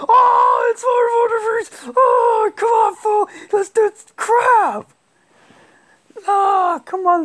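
loud high yelling through the match; excited shouting. Real, not acted. Gamer in a tense match yelling at his opponent, loud high excited shouting. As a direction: scream at the screen in the heat of it.

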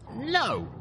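firm refusal in a light high voice; stern. A narrator's reading. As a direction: say no firmly, lightly voicing a young mare. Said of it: "I told him sternly".

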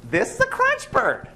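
very high, sing-song wife voice; proud wife. Acted. Wife showing the crunch bird to her husband, very high sing-song voice.

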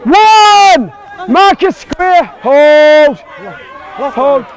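loud referee calls across the field; shouting calls. Real, not acted. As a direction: bark the calls out high and loud.

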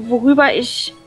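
ordinary talk, nothing marked

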